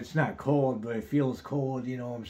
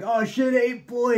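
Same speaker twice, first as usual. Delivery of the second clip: excited recognition; 12 semitones higher, 8 dB louder, slower than usual. Retold or imitated. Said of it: "And they're like".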